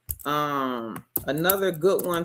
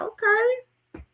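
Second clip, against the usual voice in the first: high sing-song okay. Real, not acted. Reacting to viewer's egg count.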